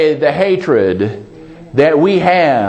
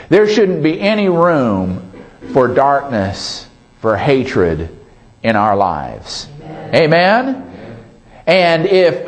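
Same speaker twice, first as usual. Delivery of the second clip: ordinary talk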